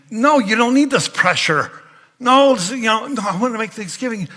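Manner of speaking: high, loud insistent pleading; protesting husband. Retold or imitated. Husband begging his sick wife not to cook. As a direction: talk her out of it, urgently. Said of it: "I was like".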